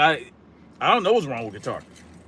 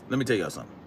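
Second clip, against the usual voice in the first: much softer, dropped low and confidential; hushed aside; 9 semitones lower, 11 dB quieter, much faster than usual. Real, not acted. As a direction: lean in and tell them quietly. Repeats line much softer.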